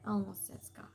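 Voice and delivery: whisper. Real, not acted. Quiet aside after name slip.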